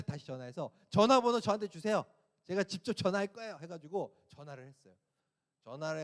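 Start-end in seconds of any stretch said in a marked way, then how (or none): 0.9-3.6 irritated demand, retold. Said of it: "화가 막 이렇게 나가지고".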